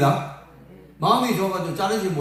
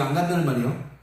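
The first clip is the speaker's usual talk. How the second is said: ordinary talk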